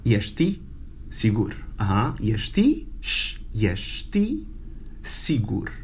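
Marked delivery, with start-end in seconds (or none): 0.0-1.5 ești with a sh sound; Romanian ești sigur. Shown as a demonstration. Teacher models ești sigur for students. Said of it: "how do you say, are you sure?". 3.0-5.7 sh, ești drawn out slowly, sh hissed; stretched sh sound. Shown as a demonstration. Teacher isolates the sh in ești for students. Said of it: "and you have the sh".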